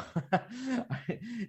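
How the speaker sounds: short, high emotional laugh; nervous laugh. Real, not acted. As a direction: laugh off the tears. Host tearing up mid question.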